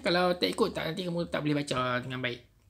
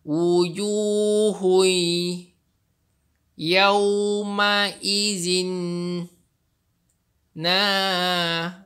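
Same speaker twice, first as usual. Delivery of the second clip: very slow, stretched recitation; Quran recitation; 4 semitones higher, 7 dB louder, much slower than usual. Shown as a demonstration. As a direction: chant each word out for the class. Teacher recites for students.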